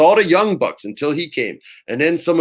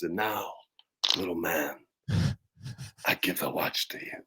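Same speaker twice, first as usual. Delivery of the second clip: hushed, much softer mock Walken line; lower, trailing into a breathy near-whisper Walken; Christopher Walken impression; 7 semitones lower, 12 dB quieter than usual. Wrestler ending his Walken speech to the class, hushed and lower. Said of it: "he does the Christopher Walken voice".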